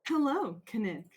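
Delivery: hint of a robot saying hello. [name] answering Kinnick in the default voice, lightly robotic. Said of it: "sounds even more like a robot".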